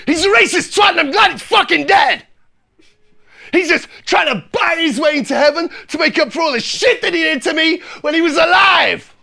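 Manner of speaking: loud, high shouted rant; sustained furious yelling, fully acted; angry outburst. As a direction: explode in acted fury at a relative. Acted passenger rants about dead granddad.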